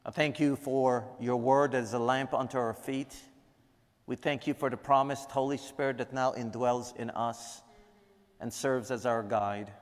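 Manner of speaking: soft, reverent prayer voice. Acted. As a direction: pray quietly to God for the room. Prayer continues.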